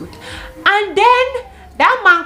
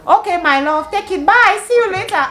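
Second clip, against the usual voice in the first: higher, sugary mock flirt; mock sweet; 10 semitones higher, 6 dB louder than usual.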